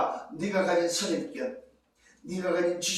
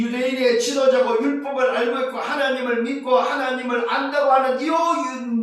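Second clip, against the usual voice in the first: ordinary talk, nothing marked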